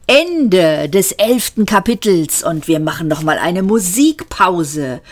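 usual speaking voice

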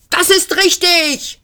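high, excited cry of agreement; shouted. A narrator's reading. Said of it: "schrie Frumpfondell". (hoher, aufgeregter Ausruf)

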